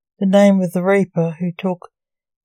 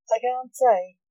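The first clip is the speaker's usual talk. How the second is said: mildly nervous doctor, slightly higher pitch; 4 semitones higher than usual. A narrator's reading. Doctor withholding his source from the nurse, mildly nervous. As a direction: hold back the answer with a touch of nerves. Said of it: "equally nervous".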